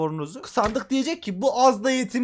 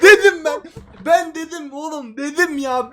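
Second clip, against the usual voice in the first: excited gloating; 5 semitones higher, 6 dB louder than usual. Real, not acted. Gloating after the win.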